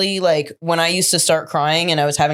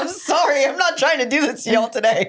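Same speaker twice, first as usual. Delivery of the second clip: teary laughter; 7 semitones higher than usual. Real, not acted. Host laughs off her tears.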